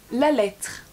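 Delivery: put-on loud, commanding old man; abrupt regal order; imperious. A narrator's reading. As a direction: demand it like a king. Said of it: "fit le vieillard impérieux".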